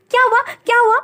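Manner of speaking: put-on high, startled child voice of a seven-year-old; high little girl voice. A narrator's reading. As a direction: call out like a small girl just woken. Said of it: "बैठकर बोली". (ऊँची आवाज़ में छोटी बच्ची)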